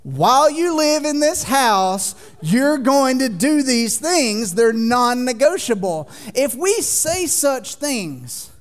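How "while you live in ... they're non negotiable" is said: slow, louder, laying down the law; firm parental rule. Retold or imitated. Parent telling kids the house rules, slow and firm. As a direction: set the rule, no debate. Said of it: "Because we're like".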